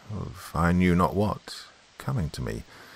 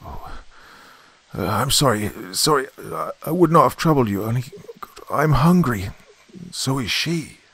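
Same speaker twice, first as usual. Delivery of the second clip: high, loud, halting plea; desperate, faltering cry for pity; hopeless and pathetic; 7 semitones higher, 4 dB louder than usual. A narrator's reading. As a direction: apologise brokenly, pleading from afar. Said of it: "sounding extremely hopeless and pathetic".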